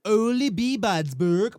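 slow Norwegian-accented name. Shown as a demonstration. Co-host demonstrates the name, Norwegian accent. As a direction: sing it out with a Norwegian lilt. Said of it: "Got to say it in the Norwegian accent".

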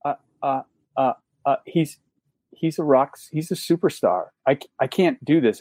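mock starstruck stuttering; halting, imitated flustered clerk; gobsmacked stammer. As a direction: stumble over the words as if overwhelmed. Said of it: "she was just gobsmacked by it".

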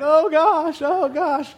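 high-pitched wailing sister; hammed-up tearful wail; mock crying. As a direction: imitate a distraught sister bawling. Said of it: "around the corner crying".

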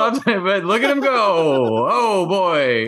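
high, excited baseball call; excited play-by-play. Acted. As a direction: call the play like a radio announcer.

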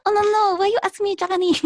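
high-pitched, hammed-up Japanese accent. Protesting the request to speak Japanese, in a mock Japanese accent. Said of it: "can you speak Japanese?".

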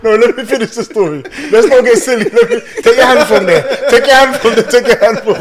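high, loud pleading through laughter; real giggly, shouted objection; laughing protest. Real, not acted. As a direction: talk over the uproar, cracking up. Protesting at friends' clowning mid-story.

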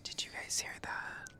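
hushed ASMR whisper. Acted. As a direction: keep it a soft whisper.

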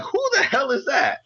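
high-pitched, drawn-out incredulous question; slow, high mock bewilderment; startled disbelief. Retelling his startled reaction to yell.

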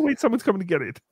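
ordinary delivery

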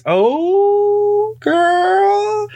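long, high held exclamation; drawn-out oh girl. Acted. Telling viewers a juicy story, in a high drawn-out squeal. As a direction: stretch it way up high.

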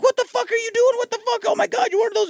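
high, shrill-pitched mock outrage; outraged girlfriend. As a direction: play the girlfriend catching him, high and appalled. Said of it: "She's like".

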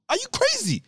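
are you crazy, squeaky high; incredulous. Real, not acted. Outraged question.

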